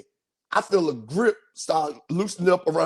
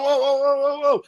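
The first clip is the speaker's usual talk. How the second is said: alarmed cry; 8 semitones higher than usual. Retold or imitated. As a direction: imitate yourself losing control of a scooter. Rider nearly crashing into woods.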